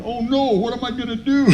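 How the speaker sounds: high, helpless retold whine; mock crying. Man imitating himself crying with book in hand, mock crying. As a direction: whimper it high, hammed up. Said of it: "I'm sitting there crying".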